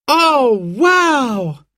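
high, thrilled exclamation; excited cry. A narrator's reading. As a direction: cry out with delight at the gift. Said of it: "cried Simon and Sarah".

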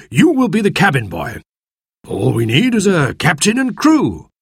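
very high, eager, slowing on the first line; excited squire. Acted. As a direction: hand out the roles with glee. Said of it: "cried the squire".

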